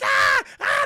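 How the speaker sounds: high squealing cries; burning screams. Acted. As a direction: scream while on fire. Gingerbread men burning.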